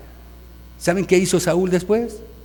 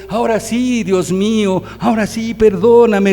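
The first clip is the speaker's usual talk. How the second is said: mock pleading sinner; 5 semitones higher, 5 dB louder than usual. Sinner's repeated plea to God.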